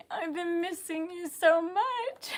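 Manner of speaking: real tears while speaking; tearful. Real, not acted. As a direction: voice breaking with tears. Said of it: "I always wanna just start bawling".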